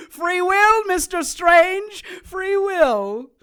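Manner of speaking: high, loud manic crowing; manic exultation. Acted. Kay exulting over his captive, high manic crowing. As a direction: crow it out in wild glee.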